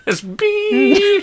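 very high electronic beep; imitated flatline beep. Dad imagining his plug being pulled, high flatline beep. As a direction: mimic a heart monitor's tone.

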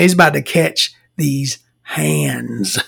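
slow, spaced-out words, HANDS stretched; drawn-out mock threat. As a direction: draw out the comic threat word by word.

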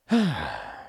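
long, falling exhale; deep sigh. A narrator's reading. Mouse stopping after the run, deep sigh. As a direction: heave a big weary sigh. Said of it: "sighed very, very deeply".

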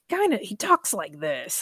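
lightly put-on squawky parrot-like voice; a hint of parrot in a mild imitation; David Lynch impression. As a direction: imitate him like a talking parrot. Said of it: "like, a, like a, how a parrot would talk".

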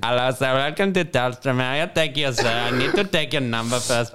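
put-on Indian call-centre accent; comic Indian-accented phone agent. Shown as a demonstration.

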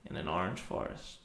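low narrator voice; a hint of a deep movie narrator; Morgan Freeman. Friend keeps the impression going, low narrator voice. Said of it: "Morgan Freeman".

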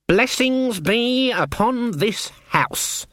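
slow, high and menacing; menacing greeting. Acted. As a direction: greet them like a threat. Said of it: "in much the same tone of voice people have said, "Eat hot lead".

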